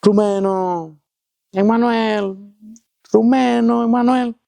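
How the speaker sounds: high, drawn-out distant search call. Retold or imitated. Searchers calling for missing men from afar, high drawn-out calling. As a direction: imitate rescuers hollering names, pitched high. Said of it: "empiezan a llamar".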